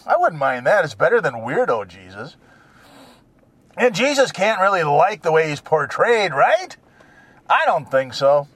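normal talking voice

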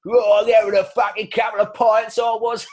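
mock drunken boast. Host jokingly pretends he was at the pub, loud mock shout. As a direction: bellow it like a bloke bragging at the bar.

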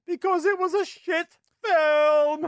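high, drawn-out call on the last word; emphatic punchline. Real, not acted. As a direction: blurt it out high and stretch the final word. Mock-emphatic punchline answer to own question.

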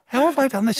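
high-pitched flustered imitation. Canteen worker puzzling over her mistake, flustered. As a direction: mimic someone rattled by a mistake. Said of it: "She's a bit flustered".

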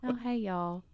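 soft Southern sweet talk; quiet, cooing Southern drawl; Southern accent. Shown as a demonstration. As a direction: coo in Southern to dogs or children. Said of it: "don't have a Southern accent".

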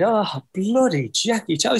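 lightly voiced Middlesbrough accent; a touch of Middlesbrough cabbie. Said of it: "Forgive my Middlesbrough accent".

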